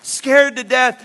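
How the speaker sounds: normal talking voice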